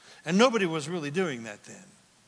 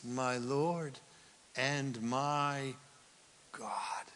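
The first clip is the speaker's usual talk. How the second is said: softer, breaking into a whispered cry; awed whisper; 3 semitones lower, 8 dB quieter, much slower than usual. Retold or imitated. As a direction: let it fall to a tearful hush. Thomas worships, kneeling.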